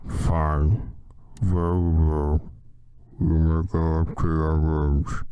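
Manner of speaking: low, slow, loud drawl; Snape voice. Acted. Snape dismissing the students, low slow drawl. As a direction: drawl it out like a bored sinister teacher.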